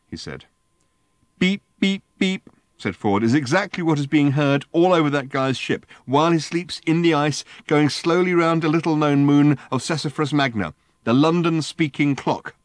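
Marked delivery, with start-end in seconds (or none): 1.4-2.5 high mechanical beeps; imitating the speaking clock. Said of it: "It's the speaking clock". 3.2-12.5 higher, louder excited explanation; excited Ford. A narrator's reading.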